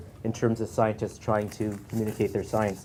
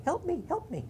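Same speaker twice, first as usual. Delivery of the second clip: tiny, much higher voice, a bit softer; a far higher, softer little voice; little frog voice; 6 dB quieter than usual. Acted. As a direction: call out small and high like a tiny frog. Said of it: "hear the voice again saying".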